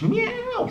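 high yowling meow; cat yowl. Acted. Mog the cat yowling as everyone falls over. Cat yowls as they fall.

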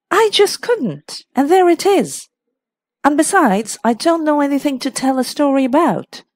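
high, emphatic protest, building in pitch; exasperated hostess. A narrator's reading. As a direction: plead helplessness as a storyteller.

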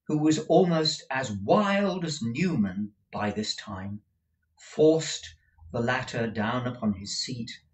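ordinary delivery, nothing marked

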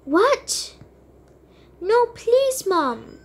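high shocked no please. Acted. Daughter protesting when mom says get your stuff. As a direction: gasp and beg.

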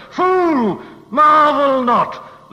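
preacher roaring the rebuke at the pygmy mind; thundering rebuke. Acted. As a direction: blast the word fool at him.

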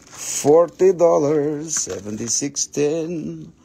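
slow, drawn-out price call; slow announcement. Shown as a demonstration. Seller calling the sale price, slow announcer style. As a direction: announce the deal slowly.

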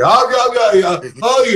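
high, loud mock wails of "ay"; imitated screams. Storyteller shows how his friend screamed, imitated screams. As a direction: wail in mock terror. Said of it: "los gritos que echaba".

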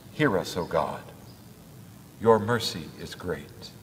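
ordinary talk, nothing marked

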